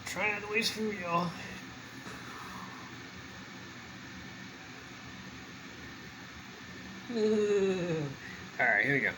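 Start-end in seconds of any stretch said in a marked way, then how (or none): 1.4-8.5 laughing. Real, not acted. Laughs after joke about wasting food.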